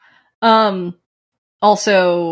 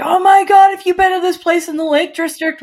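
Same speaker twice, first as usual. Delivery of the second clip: excited gush; 12 semitones higher, 4 dB louder than usual. Retold or imitated. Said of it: "It was like".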